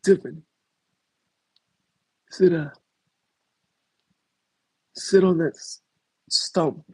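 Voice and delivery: high, put-on mafia boss voice; mafia boss Sari. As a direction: lay down the law like a mob boss. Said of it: "They're gonna say".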